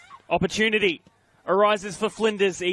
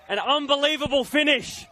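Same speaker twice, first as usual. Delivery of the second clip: excited; 9 semitones higher than usual. Real, not acted. Reacting to goal.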